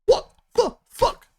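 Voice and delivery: high, loud, slow stunned "what the fuck"; replaying his stunned reaction to his wife; disbelieving outburst. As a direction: squeal it out in total disbelief.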